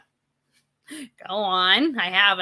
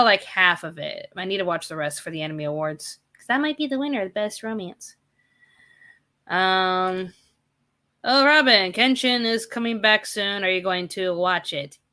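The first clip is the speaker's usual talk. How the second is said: ordinary talk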